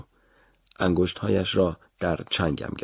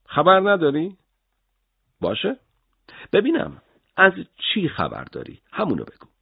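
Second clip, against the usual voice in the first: sharp, much higher sarcastic challenge; mocking anger; 11 semitones higher than usual. Interrogator mocking the boy's claim of ignorance, scornful. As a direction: throw his words back at him, scornful.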